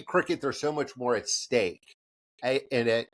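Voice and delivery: ordinary talk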